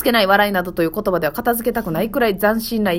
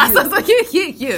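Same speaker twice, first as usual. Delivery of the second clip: higher, louder "ヒューヒューヒュー" with a laugh; impression call; 7 semitones higher, 4 dB louder than usual. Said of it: "返事する時". (笑いながら[name]の返事を真似して)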